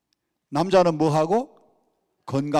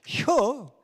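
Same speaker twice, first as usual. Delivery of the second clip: slow, drawn-out Chungcheong country reply; lazy Chungcheong drawl, clipped to two syllables; 느릿한 충청도 사투리로; Chungcheong dialect; 8 semitones higher than usual. Retold or imitated. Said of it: "충청도 사람들은 간단해요".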